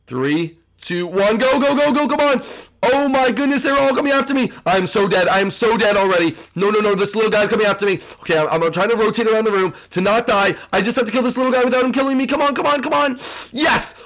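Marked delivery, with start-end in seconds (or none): 1.4-4.5 high-pitched, urgent game panic; frantic panic. Real, not acted. Player rushed by zombies in the room, frantic panic. Zombie fight starts, they all attack. 10.7-13.2 high, urgent rush of words; frantic urging. Real, not acted. Player trying to kill the little guy, frantic urging. Fighting for survival in the game.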